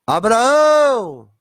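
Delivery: high, loud, long-drawn call; calling shout. Acted. Jesus calling Abraão in paradise, drawn-out shout. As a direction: call the name out across a great distance. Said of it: "Ele grita".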